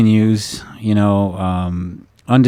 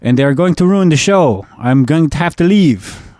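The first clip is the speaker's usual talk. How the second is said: imitating an upset Ukrainian man, pitched higher; Mediterranean or Ukrainian accent; 6 semitones higher than usual. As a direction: put on a higher-voiced Eastern European accent to complain. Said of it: "Ukrainian or something, walked up to me".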